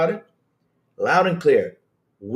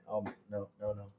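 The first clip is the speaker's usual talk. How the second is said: usual speaking voice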